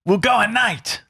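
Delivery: high-pitched, badly faked Russian accent; unconvincing comic Russian accent; attempted Russian accent. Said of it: "I don't think it was Russian".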